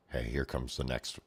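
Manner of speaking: a low, soft first try at the call; muttered call before shouting it; quiet call. Retold or imitated. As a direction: say it under your breath, half to yourself. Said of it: "thug calling out, first try".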